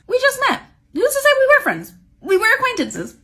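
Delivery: high-pitched, indignant pushback; incredulous dismissal. Real, not acted. Storyteller disowning a brand-new friendship, incredulous high pitch. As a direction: throw it out high and disbelieving.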